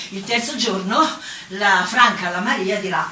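usual speaking voice

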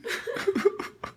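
soft high laugh through tears; unvoiced teary chuckle; breathy tearful laugh. Real, not acted. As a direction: laugh while holding back crying.

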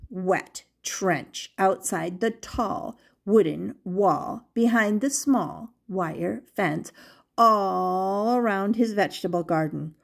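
ordinary delivery

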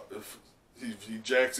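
ordinary delivery

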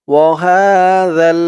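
ওয়াহাযাল with a soft, thin যা, tongue tip at the teeth; soft thin zal. Shown as a demonstration. Teacher showing the soft zal sound, slow chant. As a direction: stretch it slowly, zal soft and light. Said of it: "জালের উচ্চারণটা একদম নরম পাতলা করতে হবে".

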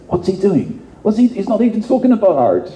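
high, fretful mock organizer; upset organizers. Organizers pacing at the back, fretting about the speaker, mock-upset. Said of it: "like, really upset".

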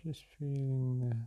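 unhurried meditation-guide voice with long pauses; guided meditation. Real, not acted. Meditation guide leading listeners through breath and release, calm and unhurried. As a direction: guide them gently through each breath, leaving space between phrases.

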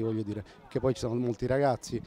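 ordinary talk

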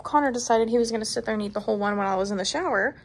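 usual speaking voice

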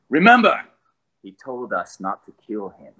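actor asked to perform his game accent for a fan; Mister Negative accent. Shown as a demonstration. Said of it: "Acting time".

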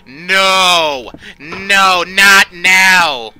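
panicked loud cries. Real, not acted. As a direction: yell in real panic. Caught by the killer.

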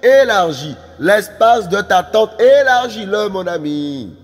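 slow, chant-like repeated call; drawn-out prophetic proclamation; preaching exhortation. Real, not acted. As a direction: stretch each command out, urging the listener on.

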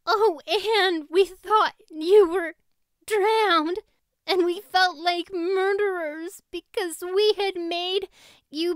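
high, loud tearful rush of relief; tearful, high and loud, relief spilling over; weeping with relief. A narrator's reading. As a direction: sob it out, overjoyed she's alive. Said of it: "weeping with relief".

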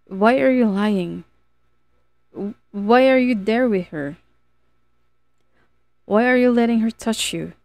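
tearful pleading. A narrator's reading. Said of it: "eyes tearing up".

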